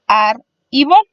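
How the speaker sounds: "ar y bol" in two separated syllables. Shown as a demonstration. Teacher dividing árbol for learners, "ar y bol" syllable by syllable. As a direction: say "ar", pause, then "y bol". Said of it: "se divide en dos sílabas".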